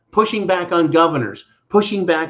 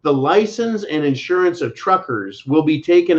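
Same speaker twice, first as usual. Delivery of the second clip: ordinary delivery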